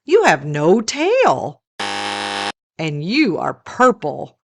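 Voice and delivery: plain reading voice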